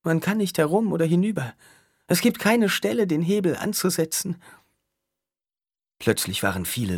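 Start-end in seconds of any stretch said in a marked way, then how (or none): 0.0-4.4 put-on high, dreamy young woman; light, distant girlish voice; young woman's voice. A narrator's reading.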